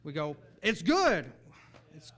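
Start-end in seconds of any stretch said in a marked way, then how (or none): 0.6-1.3 loud, high burst of enthusiasm; exuberant cheer. Acted. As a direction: shout it like a fan in the stands. Acts out crowd-like cheer.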